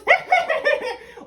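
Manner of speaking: high, loud cackle; a gleeful shrieking hag's laugh; cackling witch. Acted.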